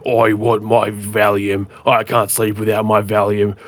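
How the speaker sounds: put-on voice of a prisoner caller; demanding prisoner. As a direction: play a prisoner demanding his pills. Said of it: "get calls from prisoners".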